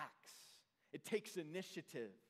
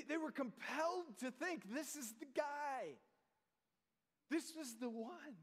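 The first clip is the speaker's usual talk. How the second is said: higher pitched, awed "This is the guy"; awed excitement; 5 semitones higher than usual. Pretend. Preacher voicing the amazed crowd, higher and eager. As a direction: marvel like a thrilled onlooker.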